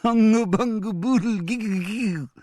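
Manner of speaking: put-on high, giggly little boy's voice; a small boy gasping out nonsense through giggles; child prisoner repeats the silly word, giggling. A narrator's reading. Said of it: "Julian repeated, wriggling free".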